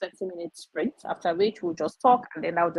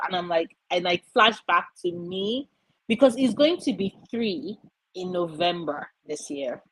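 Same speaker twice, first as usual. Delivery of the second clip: ordinary delivery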